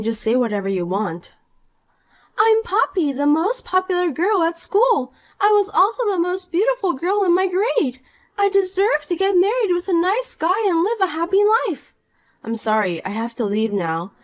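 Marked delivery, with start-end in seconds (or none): 2.4-11.9 put-on bright, higher voice of a smug mean girl; snide rich girl. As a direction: talk down to her with sweet contempt. Poppy brags.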